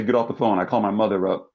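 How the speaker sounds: ordinary delivery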